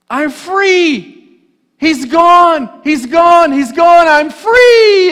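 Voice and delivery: very high, loud cry of relief; joyful shout. Acted. Smeagol rejoicing that Gollum is gone, high and loud. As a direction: shout your freedom to the sky.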